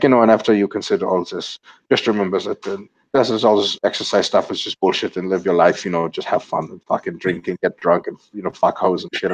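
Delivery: put-on casual, shrugging European accent; mock Euro accent. Quoting a Swedish coach's article on eating, mock Euro accent. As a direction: do a loose, generic Euro accent. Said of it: "I'm just gonna do my Euro accent for him".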